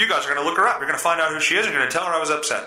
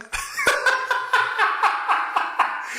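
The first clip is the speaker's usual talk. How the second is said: wheezing laugh. Real, not acted. Laughing at own story.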